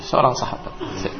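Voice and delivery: ordinary delivery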